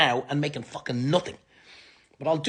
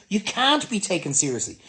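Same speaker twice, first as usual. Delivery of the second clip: ordinary talk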